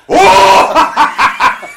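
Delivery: loud, high whooping fake laugh; phoniest horse laugh. Real, not acted. Laughing loudly at the impersonator's bad joke. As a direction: bray it like a horse on purpose. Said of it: "phoniest horse laughing".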